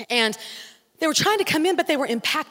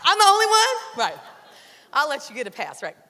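ordinary talk

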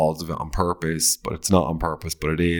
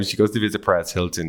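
ordinary talk, nothing marked

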